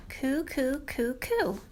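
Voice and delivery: quiet cooing like a morning dove; soft dove coo. Retold or imitated. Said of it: "Morning doves take their morning stroll".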